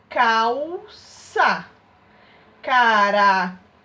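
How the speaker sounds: calça and caracol loud, caracol drawn out slowly with the final L as U; demonstrating final L. Shown as a demonstration. Teacher showing the class that a final L sounds like U, loud and slow. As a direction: stretch caracol so the U sound shows. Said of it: "ela possui qual som?".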